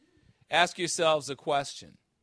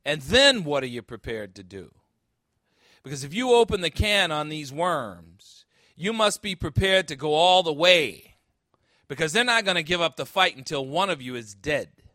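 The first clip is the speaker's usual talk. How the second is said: louder, driving warning in a hard-edged voice; forceful movie cop; 3 semitones higher, 9 dB louder than usual. Acted. Preacher voicing the cop warning Ness, louder forceful delivery. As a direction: lean in and press the threat. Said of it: "And Jim Malone says".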